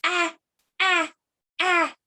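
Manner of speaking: lightly voiced reaching baby. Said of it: "she lifts up her hand and says".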